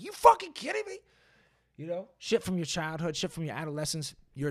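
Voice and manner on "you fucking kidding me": high-pitched disbelieving cry; mock outraged squeal; incredulous outburst. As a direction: shriek at the sheer nerve of it. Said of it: "I'm like".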